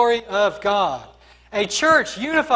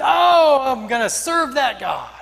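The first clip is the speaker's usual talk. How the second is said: mock enthusiasm; 3 semitones higher, 4 dB louder than usual. Mock onlooker's reaction.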